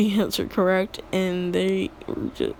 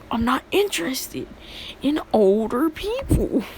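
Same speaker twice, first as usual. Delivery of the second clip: higher-pitched mock indignation; indignant rebuff; 5 semitones higher than usual. Hero telling the creepy villain off, mock indignant. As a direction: push back in an offended, raised voice.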